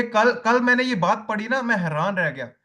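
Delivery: ordinary talk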